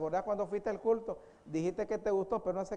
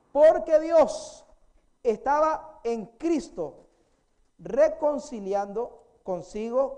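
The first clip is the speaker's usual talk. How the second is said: loud, very slow, high start; emphatic pulpit reading; forceful preaching; 5 semitones higher, 9 dB louder, much slower than usual. Acted. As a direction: declaim the verse word by word. Preacher reads verse with force.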